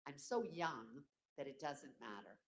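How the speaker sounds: usual speaking voice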